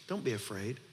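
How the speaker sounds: soft, low and tender; gentle reassurance. Acted. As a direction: say it softly, like comforting a frightened father. Gentle retelling of Jesus' words.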